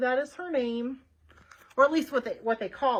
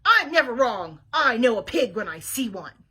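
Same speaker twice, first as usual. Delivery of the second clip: louder, stubborn insistence; indignant shout; 8 dB louder than usual. Acted. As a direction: dig in, certain of herself. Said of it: "Eugenia shouted".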